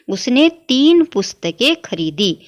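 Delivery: खरीदी read slowly with a long clear ई at the end; slow, careful model sentence. Shown as a demonstration. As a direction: spell out उसने तीن पुस्तकें खरीदी slowly, word by word. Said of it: "इसका शुद्ध क्या होगा".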